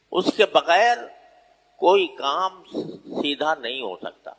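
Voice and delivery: usual speaking voice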